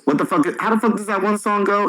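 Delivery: usual speaking voice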